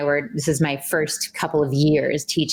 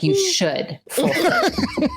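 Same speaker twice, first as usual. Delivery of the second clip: high, slow, laughing mock demand; giggling through a mock demand; laughing mock entitlement; slower than usual. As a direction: ham up your old entitled self. Mock entitled self-quote laughing.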